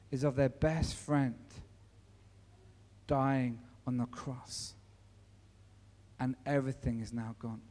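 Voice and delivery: grieving softly over the cross; somber, hushed. Acted. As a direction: let the loss sink in quietly. Somber reflection on Jesus' death.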